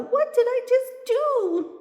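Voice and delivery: high, loud, horrified question; high-pitched panicky cry; alarmed shout. A narrator's reading. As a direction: exclaim in dismay at your own blunder. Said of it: "shouts the little spirit doll".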